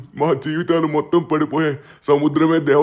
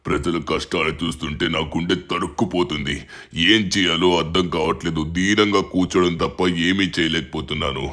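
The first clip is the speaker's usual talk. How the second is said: put-on much lower, heavy-hearted old official's voice; weary president; 11 semitones lower than usual. Troubled president tells the boy of drought, low and weary. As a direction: sink the voice low and sigh out the worries.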